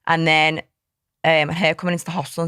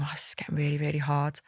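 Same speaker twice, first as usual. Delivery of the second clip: whispered, strained and weary; whispered thought; 9 dB quieter than usual. Retold or imitated. Dreading each week of pregnancy alone, a weary whisper. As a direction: whisper it to yourself, worn down. Private worried thought, retold.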